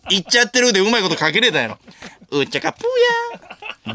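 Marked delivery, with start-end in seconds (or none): 2.3-3.9 squeaky high, slow gag voice; comic catchphrase. Retold or imitated. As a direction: shriek it out long like a cartoon character.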